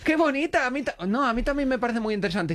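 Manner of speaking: high, forced enthusiasm; feigned admiration. Retold or imitated. Hiding disgust so the date isn't spoiled, high feigned admiration. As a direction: fake being charmed, pitched well up. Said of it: "por no estropear la cita".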